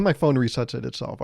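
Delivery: ordinary delivery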